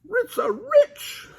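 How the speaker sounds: very high slow dog-speak; high scared Scooby; Scooby barking. Acted. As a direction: bark in fright. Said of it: "barked Scooby-Doo".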